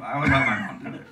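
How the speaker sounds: usual speaking voice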